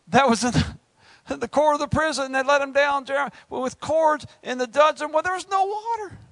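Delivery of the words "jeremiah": ordinary talk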